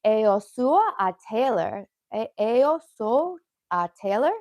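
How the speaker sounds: slow learner Portuguese, "Eu sou a Taylor" spelled out syllable by syllable; careful Portuguese. Shown as a demonstration. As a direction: sound out "Eu sou a Taylor" like a beginner. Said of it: "I'm learning Portuguese".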